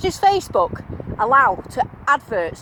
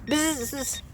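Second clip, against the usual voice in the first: detector buzz; 2 semitones higher, 6 dB quieter than usual. Retold or imitated. Imitating the metal detector.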